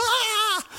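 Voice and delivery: high imitated wail; high, drawn-out mock scream of a kid; child's scream. Kid mucking up in the checkout, high mock screaming. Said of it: "why that kid's going".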